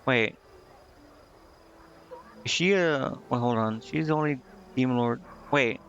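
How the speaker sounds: ordinary talk, nothing marked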